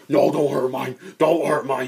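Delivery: loud desperate plea, "No" hit hard; pleading shout. Retold or imitated. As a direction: beg them loudly to stop. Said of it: "Elrosk is just like".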